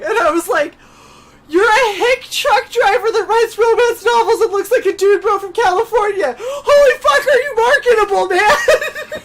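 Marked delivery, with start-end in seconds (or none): 1.5-9.2 high, loud, laughing disbelief; excited shout. Retold or imitated. Retelling her reaction to the trucker, laughing excited shout. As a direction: shriek it out like you just struck gold.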